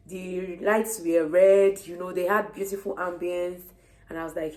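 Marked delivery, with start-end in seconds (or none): none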